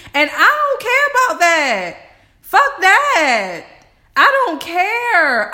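loud, high, forceful; defiant outburst. Real, not acted. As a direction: shout your freedom from others' eyes. Passionate outburst against judgment.

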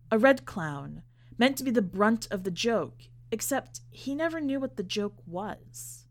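usual speaking voice

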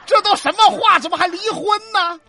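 high, loud, fast disbelief; shocked outcry. Real, not acted. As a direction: blurt it out in disbelief. Shocked protest at partner's joke. (惊呼着不敢相信)

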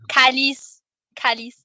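proper France French accent on "calice". Shown as a demonstration. Showing co-hosts how tame it is in a French accent. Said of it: "like, a French accent".

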